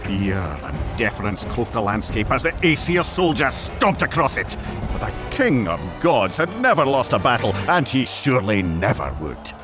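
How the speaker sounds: ordinary delivery, nothing marked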